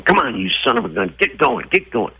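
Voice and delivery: impatient rider yelling, half-acted; mock angry yell. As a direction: snap at the horse in mock anger. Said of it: "if you start to get mad".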